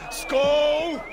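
shouted toast. Acted.

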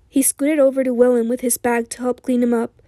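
plain reading voice

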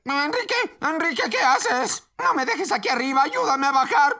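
high-pitched panicky pleading; súplica asustada. Acted. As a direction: plead urgently to be helped down. Beto stranded, pleads for help. (súplica aguda, con pánico)

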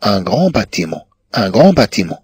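normal talking voice

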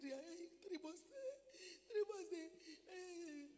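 high wailing imitation of a sobbing woman; imitated high-pitched tearful lament; mock crying. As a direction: wail the complaint in tearful bursts. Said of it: "was crying that they are owing".

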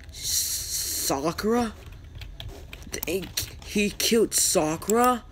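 high, drawn-out disbelieving question; stunned, stretch it out and lift the pitch; wide-eyed shock. Acted. Kakashi hears that Sakura was killed, shocked. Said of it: "Kakashi eyes went wide".

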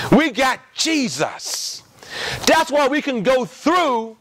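ordinary delivery

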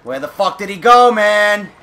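frustrated yell. Real, not acted. Lost the deer again.

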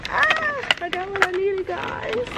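high, thrilled little cry of success; excited. Real, not acted. Telling viewers she found her charger, excited. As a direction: squeal it out like you just won.

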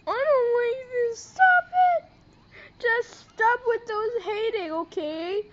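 high, loud protest rising to a shriek; distressed yell. Real, not acted. Vlogger begging haters to stop, shrieking. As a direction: shriek it out, upset.

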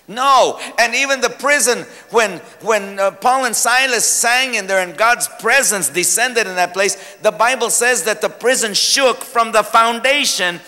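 usual speaking voice

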